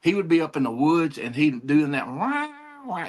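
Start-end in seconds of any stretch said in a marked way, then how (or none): 2.1-3.1 high, thin mock yowl of a tomcat; imitated cat yowl. Telling friends how the woods cat cried out, high mock yowl. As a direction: wail like a wild cat in the woods. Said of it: "it sounded horrible".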